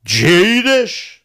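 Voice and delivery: "Gdje ideš" drawn out slowly and high, with a twang; slow southern twang on "Gdje ideš"; southern Montenegrin twang. Shown as a demonstration. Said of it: "versus South".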